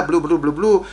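quick babbling blah-blah; mimicked gibberish chatter. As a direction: imitate talk you cannot follow. Imitates unintelligible talk between officers.

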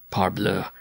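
ordinary delivery, nothing marked

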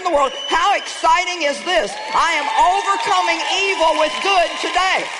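rally the church like a victory cry; full-force sermon climax; fiery preaching. Acted. Building to triumphant preaching.

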